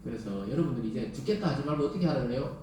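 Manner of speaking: usual speaking voice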